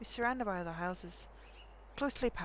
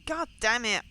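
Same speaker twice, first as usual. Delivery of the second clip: a loud frustrated curse; angry curse; 4 semitones higher, 9 dB louder than usual. Acted. Shouting a curse in frustration. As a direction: swear out loud at the family. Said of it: "Harry, your language!".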